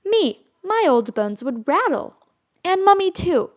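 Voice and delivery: playful fake shock; mock scandalized. A narrator's reading. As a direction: protest in jest. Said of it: "pretended to be scandalized".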